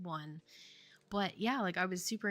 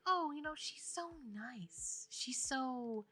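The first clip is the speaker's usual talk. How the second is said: put-on higher, drawn-out girl-crush gushing; imitated gushing; 6 semitones higher, 11 dB louder, slower than usual. [name] quoting how speaker talks about [name], imitated gushing. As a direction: coo slowly, searching for innocent praise. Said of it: "the way you would say things".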